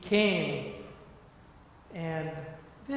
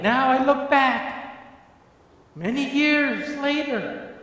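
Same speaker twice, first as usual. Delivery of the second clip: high, loud praise to God; full-force preaching; 8 semitones higher, 13 dB louder than usual. Acted. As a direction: pour out thanks at the top of the voice.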